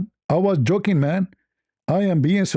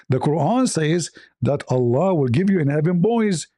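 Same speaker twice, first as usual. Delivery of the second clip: normal talking voice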